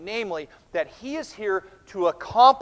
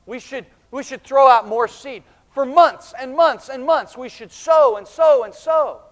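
loud, raised, hammered repetitions; mock insistence; 5 semitones higher, 10 dB louder than usual. Disciples argue for longer sowing, mock insistence. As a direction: pound out each repeated word insistently.

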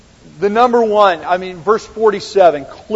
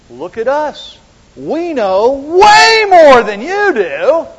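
high, loud, drawn-out superiority; prideful boasting; 9 semitones higher, 9 dB louder, slower than usual. Acted. Leaders pulling rank on the officers, pridefully superior. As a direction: boast loudly, stretching it out high. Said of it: "lean in on their own name".

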